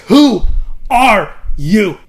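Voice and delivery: very loud, high shout, word by word; furious shouted demand. Acted. Queen hits Jon again, shouting her demand furiously. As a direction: shout it with a break after the first word. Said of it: "she hits him again".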